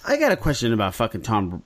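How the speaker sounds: usual speaking voice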